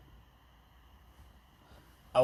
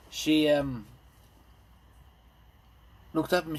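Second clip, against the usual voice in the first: ordinary delivery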